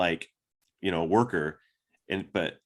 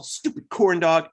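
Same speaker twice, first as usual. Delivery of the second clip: higher, louder and slow, a hammed-up angry jab; mock upset insult; 8 semitones higher, 5 dB louder, slower than usual. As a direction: spit it out like a sulky insult. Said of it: "she was upset".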